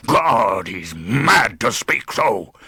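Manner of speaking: loud, slow, savage outrage; furious. A narrator's reading. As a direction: hurl each word at the guest in rage. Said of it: "thundered Heathcliff with savage vehemence".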